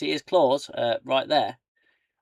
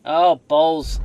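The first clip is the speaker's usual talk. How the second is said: annoyed exclamation; 5 semitones higher, 15 dB louder than usual. Real, not acted. Gamer annoyed at mistake.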